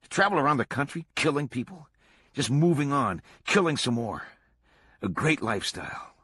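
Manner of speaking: excitedly picturing it, easing off at the close; excited musing. A narrator's reading. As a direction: relish each phrase, enthused. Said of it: "amazed at how excited Swango was".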